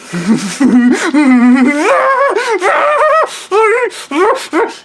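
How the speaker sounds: high shouted crazy cackle; long shrill hammed-up cackle; maniacal cackle. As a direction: cackle like a madman. Stu's laugh.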